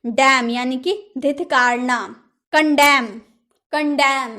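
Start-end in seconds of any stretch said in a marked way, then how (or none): 2.5-4.4 कंडेम with no final n; silent n. Shown as a demonstration. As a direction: say कंडेम ending on m. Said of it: "कंडेमन नहीं बोलेंगे ना". (कंडेम, न की आवाज़ नहीं)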